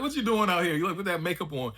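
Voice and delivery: high-pitched, incredulous husband imitation; mock scolding husband. Acts out husband scolding costumed wife.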